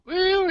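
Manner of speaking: high-pitched celebratory woo; excited whoop. Real, not acted. Panelist cheering his coming Jamaica trip, excited whoop. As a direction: let out a high, drawn-out whoop.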